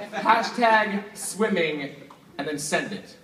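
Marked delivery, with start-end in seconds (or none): 0.1-1.9 hashtag swimming drawn out slowly, each word apart; very slow dictation. Real, not acted. Dictating the tweet to helper.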